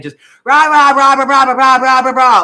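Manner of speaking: loud barking rah rah to mimic an argument; mock dog barking. Acting out the face-to-face argument with Mike, loud mock barking. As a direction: bark it out like two dogs fighting. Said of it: "like dogs".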